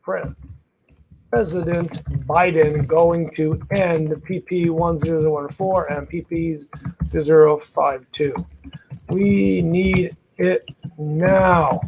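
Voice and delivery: slow, low, word-by-word dictation; dictating while writing. Real, not acted. Host composing a tweet for viewers to copy, dictating slowly. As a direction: draw each word out as you write it.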